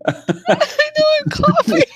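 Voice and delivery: high, loud, laughed-out words; laughing and coughing. Real, not acted. Player to friends while choking on laughter, laughing hard. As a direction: choke it out through real laughter.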